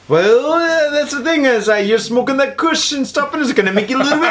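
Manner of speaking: high, swooping cartoon bear; hammed-up cartoon bear voice; Yogi Bear. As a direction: avuncular bear explaining to his little pal. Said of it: "then Yogi Bear's like".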